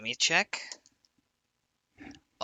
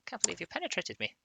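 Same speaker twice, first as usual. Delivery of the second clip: lightly Scottish high-pitched monster voice; a hint of a Scottish brogue, higher; Scottish accent; 10 semitones higher, 5 dB quieter than usual. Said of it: "This is a Scottish one".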